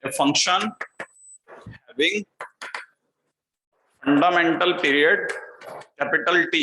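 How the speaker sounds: short phrases with long gaps; slow dictation. Real, not acted. Teacher dictating the next point to students, slow dictation. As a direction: dictate phrase by phrase for note-taking.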